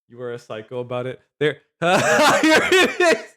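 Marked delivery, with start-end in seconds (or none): 1.8-3.2 loud, high real laugh over the words; helpless laughing outburst; bursting out laughing. Real, not acted. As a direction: crack up as you find it. Finds the monkey video, laughing.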